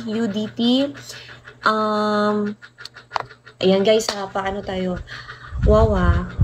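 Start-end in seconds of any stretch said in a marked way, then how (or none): none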